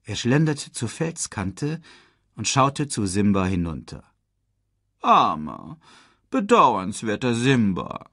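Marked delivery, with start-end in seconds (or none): 5.0-8.1 spöttisch gedehnt, herablassend; höhnisch. A narrator's reading. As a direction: mit falschem Mitleid verhöhnen. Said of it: "höhnte Scar". (höhnischer Ton)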